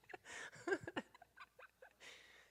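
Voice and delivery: high laughter tailing off; real giggling. Real, not acted. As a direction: giggle high, letting it die away. Keeps laughing at herself.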